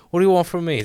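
usual speaking voice

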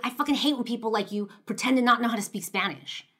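loud, accusing outburst; bursting out angrily. Retold or imitated. Stranger accusing the young girl of hiding her Spanish, loud outburst. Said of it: "finally he bursts out".

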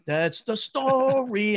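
heel traag en hoog uitgesproken slotzin; slow punchline. Retold or imitated. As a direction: rek de woorden breed uit. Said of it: "And I'd lift up my shirt".

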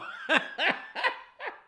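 airy high giggle; breathy laugh. Real, not acted. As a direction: laugh off the embarrassment. Laughs it away.

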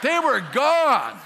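slow, loud, triumphant punchline; emphatic. Real, not acted. Preacher finishes his Jamaica story to the congregation, loud and emphatic. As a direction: land it loud and slow for the crowd.

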